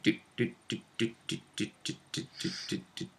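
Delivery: imitated ticks fading to a whisper; mock health bar draining, fading out; health ticking down. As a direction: tick the health away, dying off to nothing. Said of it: "my health is going like".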